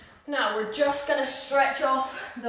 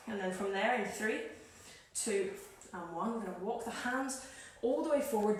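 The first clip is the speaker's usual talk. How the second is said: softer, unhurried cool-down voice; relaxed instructor guiding a cool-down; calm stretch cueing; 2 semitones lower, 6 dB quieter than usual. Real, not acted. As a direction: ease them gently through the stretch.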